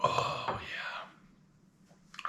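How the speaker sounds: soft, voiceless oh yeah; whispered oh yeah. Real, not acted. Feeling the chip's heat settle, real whisper. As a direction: breathe it out as the burn settles. Savouring heat, breathy aside.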